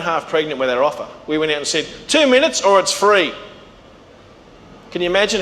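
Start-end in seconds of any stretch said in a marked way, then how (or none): 2.1-3.3 loud, higher-pitched public proclamation; bold slogan announcement. Retold or imitated. Company announcing its guarantee to the market, proclaimed boldly. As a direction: declare the offer loud and high.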